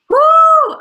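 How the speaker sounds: loud high cheering woo; excited whoop. Real, not acted. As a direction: let out a big fired-up woo. Fired-up cheer.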